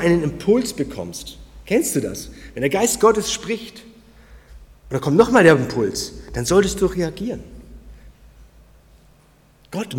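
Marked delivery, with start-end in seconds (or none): none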